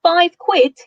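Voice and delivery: high, loud fake British accent, mock shock; hammed-up British shopper in a fake British accent. A narrator's reading. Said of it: "Ben shouts in a fake British accent".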